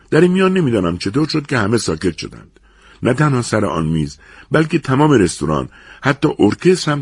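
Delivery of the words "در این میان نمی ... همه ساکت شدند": usual speaking voice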